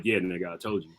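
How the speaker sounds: low and soft, smug; gloating low, under your breath; low mock gloating. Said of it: "Get a text like".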